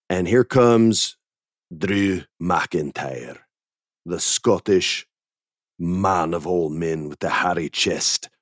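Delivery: slow dramatic ring-announcer build-up; slow hammed-up entrance call. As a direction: drag it out like a ring announcer introducing a star. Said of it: "announcing an entrance".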